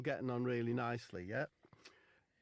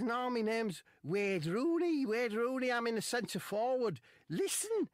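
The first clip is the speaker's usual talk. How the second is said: footballer's voice; 13 semitones higher, 7 dB louder, slower than usual. Retold or imitated.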